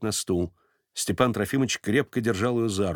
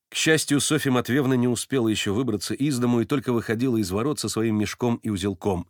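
ordinary delivery, nothing marked

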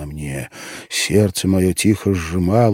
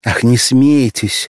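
lively, louder, slower plea; with animation; 5 semitones higher, 7 dB louder, slower than usual. A narrator's reading. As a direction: stop his laughing eagerly. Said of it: "проговорила она с живостью".

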